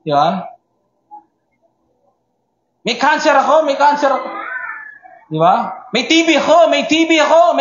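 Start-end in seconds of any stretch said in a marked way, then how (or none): 2.9-4.3 high, raised voice announcing the illness; a sick person warning everyone, hammed-up; mock calling out. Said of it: "sumisigaw ka". 5.9-7.6 high, raised voice announcing the illness; a sick person warning everyone, hammed-up; mock calling out. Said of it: "sumisigaw ka".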